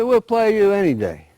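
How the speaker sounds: high, loud cocky retort; cocky reply. Acted. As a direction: toss it back boldly. Animals accept challenge.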